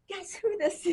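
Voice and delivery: high, playful excited question; excited teasing. Retold or imitated. Calling her old friend on the phone, high playful excitement. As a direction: tease her with a bright high lilt. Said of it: "and I was like".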